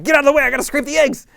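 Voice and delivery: high, loud, mock-frantic snap; hammed-up loud impatience, pitched high; impatient parent outburst. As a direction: blurt it like a hurried parent losing patience. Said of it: "I'm like".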